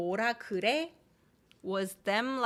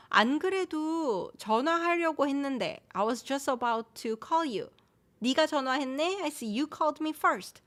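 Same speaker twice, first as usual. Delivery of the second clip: higher-pitched, lively reply to a well-timed call; pleasantly surprised; 5 semitones higher, much faster than usual. Acted. Speaker answers a friend who called first, pleasantly surprised. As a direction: act out happy coincidence, pitched up.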